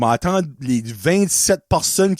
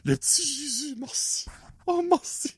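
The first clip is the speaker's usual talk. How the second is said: breathy relief; 8 semitones higher, 11 dB quieter than usual. Retold or imitated. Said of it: "Moi, j'étais comme".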